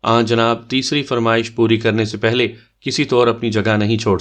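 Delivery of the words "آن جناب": ordinary delivery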